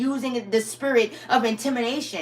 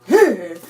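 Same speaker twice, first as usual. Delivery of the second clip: quick loud laugh in the middle of a sentence; a real laugh you can't hold in; short laugh; 3 semitones lower, 8 dB louder than usual. Real, not acted. Laughing at enemies' voodoo.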